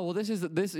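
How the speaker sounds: ordinary talk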